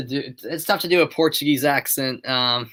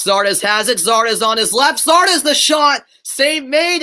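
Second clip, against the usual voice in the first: rising from lively to a high, loud shout on the shot; excited build to a shout; 8 semitones higher, 4 dB louder, slower than usual. Acted. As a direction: call the shot at full voice, building with each pass. Shot on goal called.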